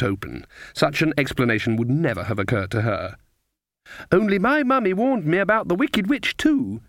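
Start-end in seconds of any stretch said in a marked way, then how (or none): 4.1-6.8 put-on high, lilting child's voice; little girl. A narrator's reading. Girl telling witches of mummy's warning, childlike. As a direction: tell it like a small child. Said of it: "said the girl".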